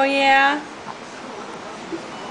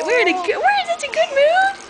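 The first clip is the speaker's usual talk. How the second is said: put-on high baby voice, a touch squeaky; much higher pretend baby voice; voicing the baby; 11 semitones higher, faster than usual. Voicing the baby's words.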